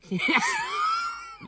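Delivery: very high shriek of laughter. Real, not acted. Choking on yogurt while eating.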